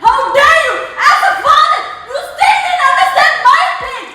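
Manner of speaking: real high-pitched, indignant outcry. Real, not acted. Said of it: "ഒച്ച വെക്കുന്നെ".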